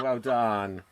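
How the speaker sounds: normal talking voice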